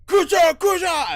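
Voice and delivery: very high, loud repeated screech; creature screaming. Acted. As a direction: shriek it out like a monster raising the alarm. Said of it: "it starts screaming".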